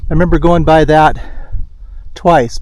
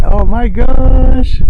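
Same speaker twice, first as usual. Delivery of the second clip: awed gasp; 9 semitones higher, 4 dB louder, much slower than usual. Real, not acted. Looking at a stunning view.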